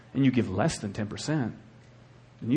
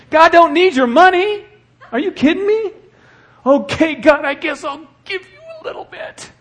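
much higher and louder, almost yelling; preaching shout; 10 dB louder than usual. Real, not acted. Pastor crying out to the congregation about money. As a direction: drive it home to the crowd.

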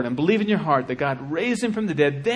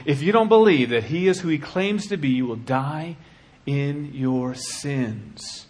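ordinary talk

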